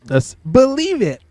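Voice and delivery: high, slow anime hero voice; Naruto catchphrase. Retold or imitated.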